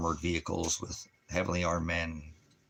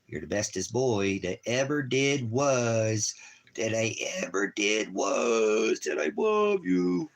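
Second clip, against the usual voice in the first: slow, drawn-out doting praise, climbing high; gushing pet talk; 4 semitones higher, 4 dB louder, slower than usual. Real, not acted. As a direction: croon it to the dog, rising to falsetto. Gushing praise at his dog.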